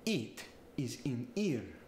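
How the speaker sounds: Italian "It is in here", short i turned to ee; staccato Italian accent with bursts on consonants. Shown as a demonstration. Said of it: "it's gonna get the E sound".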